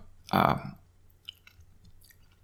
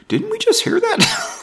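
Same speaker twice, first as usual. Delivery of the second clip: amused surprise. Real, not acted. Notices repeated verse.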